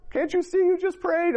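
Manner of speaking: mimicking his wife's tearful wail; mock bawling. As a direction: imitate her crying out through tears. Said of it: "She's bawling".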